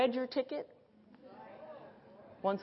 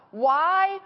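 slow, loud and high; loud, slow why; exasperated protest; 6 semitones higher, 5 dB louder, slower than usual. Real, not acted. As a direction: demand it in disbelief. Preacher rebuking the complaint.

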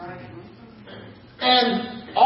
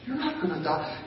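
softer, gentle, calming the lie; 4 semitones lower, 11 dB quieter than usual. Retold or imitated. Serpent to Eve in Eden, soft calm voice. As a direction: lull her like the serpent would. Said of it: "He said it in such a soft voice".

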